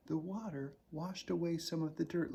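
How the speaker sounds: usual speaking voice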